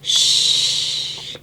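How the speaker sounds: soft shush. Real, not acted. Telling children to hush at bedtime. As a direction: hush them gently.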